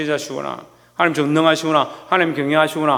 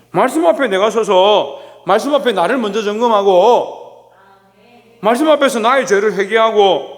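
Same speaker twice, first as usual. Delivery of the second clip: full-force preaching; 5 semitones higher, 5 dB louder than usual. Acted. Pastor urging the congregation to stand before the Word, full-force preaching. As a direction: drive each phrase home from the pulpit.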